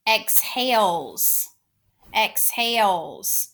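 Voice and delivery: EXHALES said very slowly, EX then a long HALES; slow, careful dictation of EXHALES; stretched word. Shown as a demonstration. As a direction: draw out both syllables of EXHALES. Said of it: "stretch, and sound out these words".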